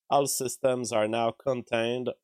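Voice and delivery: ordinary delivery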